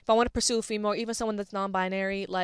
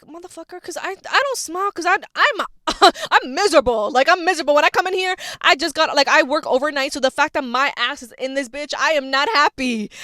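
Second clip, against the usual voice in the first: exasperated rant; 5 semitones higher, 8 dB louder than usual. Real, not acted. Ranting about hating the gym.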